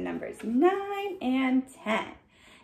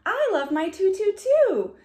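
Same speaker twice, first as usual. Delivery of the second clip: plain reading voice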